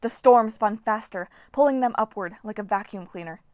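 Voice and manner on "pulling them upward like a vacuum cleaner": ordinary delivery, nothing marked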